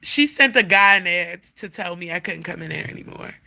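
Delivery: ordinary talk, nothing marked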